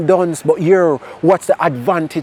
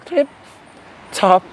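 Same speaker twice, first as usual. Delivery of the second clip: playful storytelling; 5 semitones higher than usual. Acted. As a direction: tell the hedgehog and turtle like a lively kids' tale. Playful animal fable telling.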